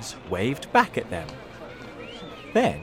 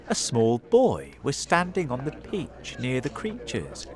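plain reading voice

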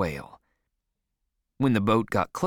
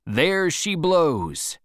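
long, drawn-out higher call; shouted; 7 semitones higher, 3 dB louder, much slower than usual. A narrator's reading. Sailor sighting a whale to the crew, drawn-out shout. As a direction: call it out slowly from the masthead. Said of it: "I shouted those three words".

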